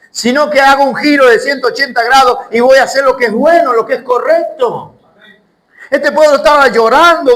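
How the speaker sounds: alto y fuerte, predicando con fuerza; predicación intensa. Acted. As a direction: sube la voz como un pastor encendido. Preaching about repentance. (predicación a todo pulmón, aguda y fuerte)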